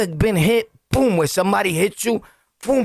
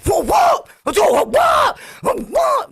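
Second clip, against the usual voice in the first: crowd oohing; slower than usual. Retold or imitated. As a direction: cheer the fight on in awe. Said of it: "And everybody's like".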